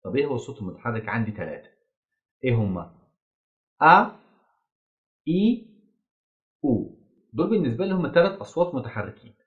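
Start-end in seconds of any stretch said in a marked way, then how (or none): none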